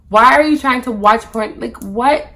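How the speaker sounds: high, loud, incredulous questions; alarmed disbelief. Retold or imitated. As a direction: burst out in appalled disbelief. Acting out her alarmed disbelief.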